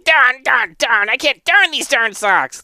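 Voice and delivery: high, loud outburst of frustration; exasperated high-pitched yelling; frustrated monk. Acted. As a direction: shout it high, fed up with the task. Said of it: "to the ground in frustration".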